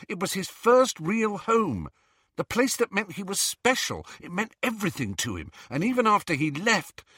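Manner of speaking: high, urgent argument; earnest, worked-up insistence; impassioned Harry. Acted. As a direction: press the case with mounting feeling. Voices Harry arguing heatedly.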